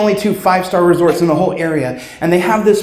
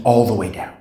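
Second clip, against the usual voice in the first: normal talking voice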